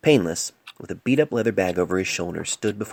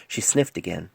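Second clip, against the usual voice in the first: plain reading voice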